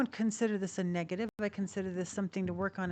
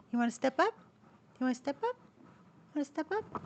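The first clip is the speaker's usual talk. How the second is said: coaxing pet talk; 7 semitones higher, faster than usual. Real, not acted. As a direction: coax him gently up onto your finger. Coaxing bird onto her hand.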